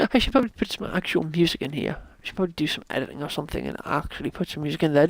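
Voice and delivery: normal talking voice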